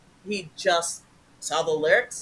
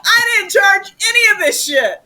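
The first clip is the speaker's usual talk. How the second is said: indignant shout; 12 semitones higher, 10 dB louder than usual. Retold or imitated. Retelling indignant denial to bank.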